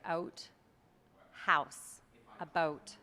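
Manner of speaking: "out", "house", "about" with rounded OW; spaced words, "about" leaning toward "a boat"; Canadian rounded vowels. Shown as a demonstration. Coach letting interviewer hear Canadian raising, rounded "out", "house", "about". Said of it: "but you can hear it".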